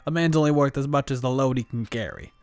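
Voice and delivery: loud tough-guy biker pep talk; Mondo simulation. Acted. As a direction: bark it like a gang leader. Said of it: "That was my attempt at a simulation".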